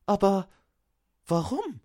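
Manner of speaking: very high, bewildered elf voice; puzzled young elf. A narrator's reading. Fenja to Arvid on hearing of the theft, bewildered. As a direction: ask it high, with a halting start.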